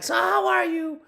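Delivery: loud, high falsetto greeting; put-on squeal of a chatty greeting; gushing greeter. Greeting someone in passing, mock gushing. Acting out an over-keen greeting.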